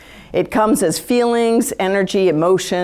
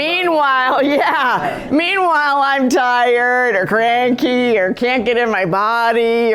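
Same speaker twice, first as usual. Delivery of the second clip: exasperated; 5 semitones higher, slower than usual. Acted. Contrasts herself, drained, with them.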